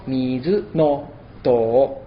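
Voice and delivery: mizu no to very slow, no and oto run together; with synalepha. Shown as a demonstration. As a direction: glide no oto into no to slowly. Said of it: "Sería una sinalefa".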